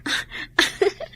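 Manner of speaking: a quick breathy giggle; short unvoiced laugh. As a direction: laugh it off lightly, shy. Teasing laugh at reply.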